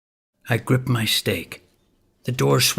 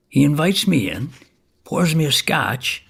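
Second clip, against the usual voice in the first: ordinary talk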